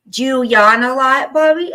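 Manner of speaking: a sudden shocked gasp; surprised gasp. Real, not acted. Reading comment aloud, gasping.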